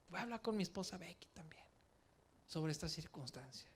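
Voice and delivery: whispered thought. Retold or imitated. Pensamiento interior retold.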